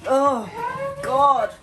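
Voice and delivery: high disgusted groan; smelling something awful. Real, not acted. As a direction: recoil from a foul smell. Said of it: "that stinks".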